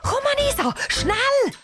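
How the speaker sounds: very high, urgent call to a friend. Acted. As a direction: call your friend over in a hurry. Calls friend over urgently to van.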